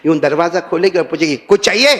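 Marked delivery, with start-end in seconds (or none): 1.5-1.9 curt, irritated question; angry wife. Retold or imitated. Wife woken at two, asking husband angrily. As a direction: snap it like an annoyed wife. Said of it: "اب اتنا غصے میں پوچھے".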